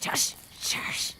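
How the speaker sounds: whispered hush, breath only; urgent whisper. Acted. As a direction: hush her fast, danger ahead. Sensing danger, hushing companion.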